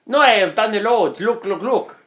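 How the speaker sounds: high, badly faked Vietnamese accent; mock-insistent driver, Vietnamese accent drifting toward Italian; attempted Vietnamese accent. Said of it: "I'm trying to do the accent".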